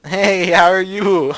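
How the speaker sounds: awkward nervous greeting. Acted. Boy greeting his date, awkwardly overloud. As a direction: stretch the greeting out awkwardly high. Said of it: "My voice cracks at the very end".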